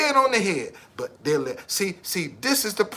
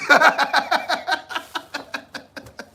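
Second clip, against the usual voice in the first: cracks up at his own joke, laughing high; big laugh; 8 semitones higher than usual. Real, not acted.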